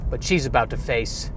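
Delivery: low mock trailer announcer; movie trailer voice. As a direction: announce the action film title low, in parody. Mock eighties action film trailer.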